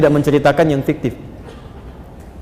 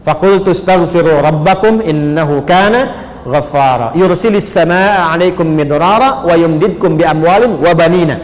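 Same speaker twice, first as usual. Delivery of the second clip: Quran recitation. Acted. (tilawah ayat Al-Quran)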